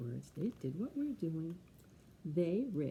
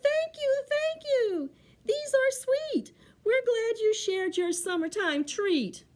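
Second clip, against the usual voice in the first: very high storybook critter voices; grateful little animals; 13 semitones higher, 8 dB louder, slower than usual. Acted. Forest friends thank Bear for berries, high voices. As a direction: pipe up thankfully, high-pitched.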